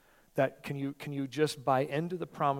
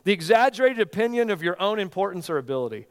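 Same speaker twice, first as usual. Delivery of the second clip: loud, high, fired-up declaration; excited preaching; 6 semitones higher, 7 dB louder than usual. Real, not acted. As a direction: preach it at full force. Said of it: "I'm getting excited".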